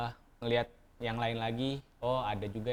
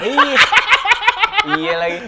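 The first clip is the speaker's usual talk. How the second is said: laughing; 16 dB louder than usual. Real, not acted. Laughing at the joke.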